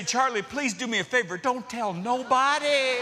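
high, mock-desperate plea; comic pleading. Comic plea to embarrassing pastor.

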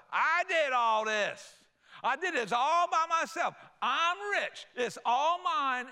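loud, high and slow bragging; boastful pride. Retold or imitated. Blessed Israelites boasting of their wealth, loud and high. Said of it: "start thinking to yourself".

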